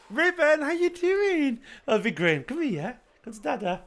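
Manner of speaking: high-pitched, sing-song greeting to a little one. Real, not acted. Dad greets his toddler son.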